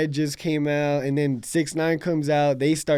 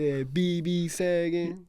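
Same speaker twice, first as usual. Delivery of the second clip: imitating 6ix9ine; 7 dB quieter, slower than usual. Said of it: "Six Nine Come on now".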